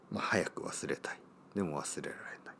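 soft unvoiced whisper. Real, not acted. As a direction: whisper it to yourself. Wistful aside, whispered.

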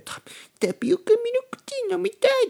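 very high, squeaky put-on girly voice; hammed-up falsetto whine; cutesy girlfriend. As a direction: play a girlfriend acting cute and pleading. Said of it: "可愛い、キュイーンって感じが猫かぶる".

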